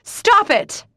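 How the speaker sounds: very loud, high screamed stop it; frantic shriek. Acted. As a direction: scream it, thrashing. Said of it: "I thrash".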